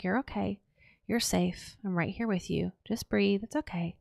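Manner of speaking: put-on soft, hushed reassurance to a frightened child; soothing inner voice. Shown as a demonstration. Soothing her scared inner self in the moment, hushed and tender. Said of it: "I tell myself".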